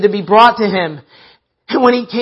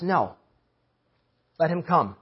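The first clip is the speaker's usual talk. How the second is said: much softer, lower, calm command; gentle Jesus; 3 semitones lower, 11 dB quieter than usual. Acted. Jesus lets the beggar approach, quietly. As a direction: quietly overrule the shouting. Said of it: "Jesus says".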